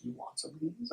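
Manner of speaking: very soft imitated offer; barely audible, questioning lift at the end; hushed offer. Retold offer from fanny pack.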